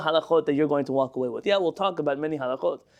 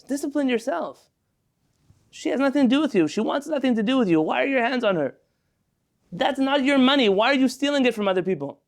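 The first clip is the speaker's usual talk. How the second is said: higher, forceful moral scolding; preaching rebuke; 7 semitones higher than usual. Acted. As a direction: hammer home each boundary. Rebuking the world at full force.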